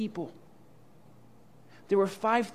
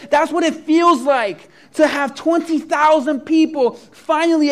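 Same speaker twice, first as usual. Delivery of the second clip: loud and higher, preaching at full force; excited preaching; 5 semitones higher, 8 dB louder than usual. Acted. Pastor dreaming aloud of twenty thousand converts, ecstatic preaching. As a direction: whip the crowd up with joy. Pastor imagines huge revival.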